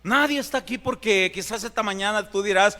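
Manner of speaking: ordinary talk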